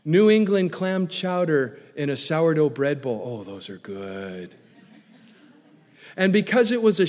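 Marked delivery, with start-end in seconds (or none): 3.2-4.5 savoring murmur. Real, not acted. Savoring the food list.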